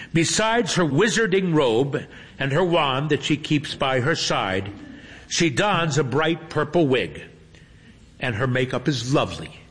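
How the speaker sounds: usual speaking voice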